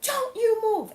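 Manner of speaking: high-pitched imitation of a screaming neighbor; acted yell, pitched well up; retold scream. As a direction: mimic a neighbor yelling at a child. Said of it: "one of my neighbors screaming at this little girl".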